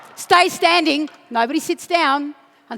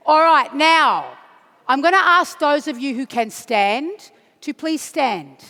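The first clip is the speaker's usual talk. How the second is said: normal talking voice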